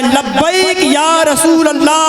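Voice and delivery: high, drawn-out rallying shout; slow, ringing slogan call; devotional slogan. Real, not acted. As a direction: lead the crowd's chant at the top of the range. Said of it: "मोहब्बत से नारा लगाएं".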